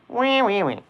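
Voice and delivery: squeaky high-pitched wees. Acted. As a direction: squeal like a little piglet. Playful squeaky noises on stream.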